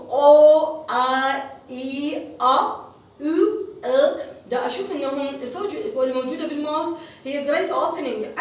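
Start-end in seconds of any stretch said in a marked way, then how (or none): 0.1-4.3 "أو، أ، إي" as separate, open, held vowels; demonstrating vowel sounds. Shown as a demonstration. Teacher showing the open mouth of vowels, isolated vowel sounds "أو، أ، إي". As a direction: sound out each vowel "أو، أ، إي" on its own, the first one loud. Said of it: "يعني أنا من ألفظ".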